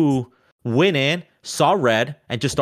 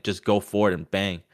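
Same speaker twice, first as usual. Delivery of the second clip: ordinary talk, nothing marked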